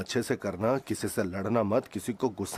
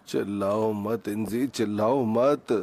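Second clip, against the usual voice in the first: drawn-out plea; slower than usual. Acted. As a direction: drag out each word at your shouting wife. Headachy husband begs for quiet. (खींच-खींचकर, धीरे-धीरे मिन्नत करते हुए)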